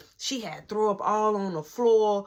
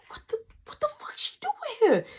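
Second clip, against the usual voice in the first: frantic unvoiced whisper, high and strained; panicked whisper; 12 semitones higher, 4 dB quieter than usual. Realizing the girl is at the door, panicked whisper. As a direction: freak out without making a sound.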